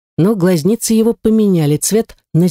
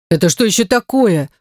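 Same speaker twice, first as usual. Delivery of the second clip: disgusted demand, rising higher; mildly outraged at the skull; a touch of revulsion; indignant; 6 semitones higher than usual. A narrator's reading. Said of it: "возмутилась Герта".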